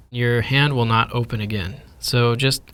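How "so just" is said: usual speaking voice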